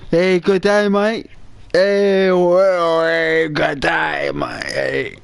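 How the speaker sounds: put-on Australian accent. Imitating an Australian soap character, mock Australian accent. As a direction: ham up an Aussie good day mate. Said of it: "It's an Aussie show".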